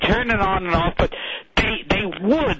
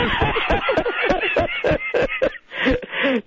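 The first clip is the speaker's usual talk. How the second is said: high, sustained burst of laughing; real laughter. Real, not acted. Hearing how the guest was edited, real high laughter. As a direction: break up laughing mid-story.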